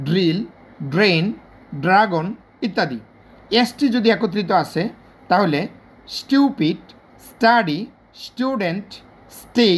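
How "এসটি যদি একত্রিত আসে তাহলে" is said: ordinary delivery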